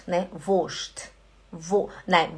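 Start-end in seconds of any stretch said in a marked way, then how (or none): 0.5-1.1 Wurst as voscht, no R; German pronunciation. Shown as a demonstration. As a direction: Wurst said short with a silent R. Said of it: "você fala Wurst".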